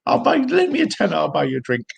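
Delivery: high-pitched mock happy drunk. Mimicking boozy streamers who agree on the flag, happy drunk. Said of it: "always pissed on the stream, and then they're really happy".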